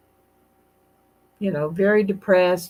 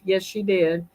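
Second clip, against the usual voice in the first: normal talking voice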